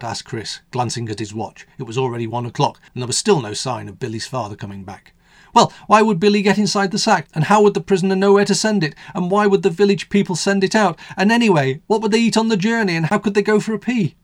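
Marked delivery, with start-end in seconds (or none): 5.5-14.2 put-on higher, louder skeptical child; child's voice. Billy picking holes in the bedtime story, higher pestering child voice. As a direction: pile on the questions like a clever kid.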